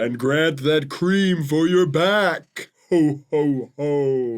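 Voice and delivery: Santa voiced as a spirit; ghost Santa voice. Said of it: "Santa always kinda sound like a ghost".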